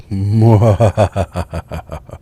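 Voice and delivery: loud villainous cackle; evil laugh. Acted. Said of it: "That's my evil voice".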